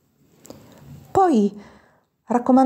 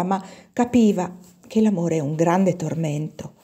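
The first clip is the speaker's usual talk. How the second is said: ordinary delivery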